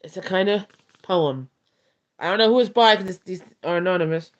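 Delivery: normal talking voice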